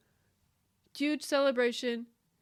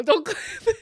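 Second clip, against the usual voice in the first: higher, louder, laughing through tears; laughing plea; 8 semitones higher, 7 dB louder, faster than usual. Real, not acted. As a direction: plead while laughing.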